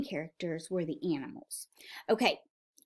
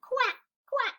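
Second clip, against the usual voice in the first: small, high little quacks; quiet duck quack. Acted. Duck after the farmer's scolding, small high quacking. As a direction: quack daintily without the volume. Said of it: "they quietly made their noises".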